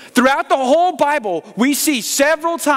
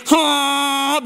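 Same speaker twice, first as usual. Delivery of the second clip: a long flat hummed horn drone; steady buzzing vuvuzela hum; 3 semitones higher than usual. Retold or imitated.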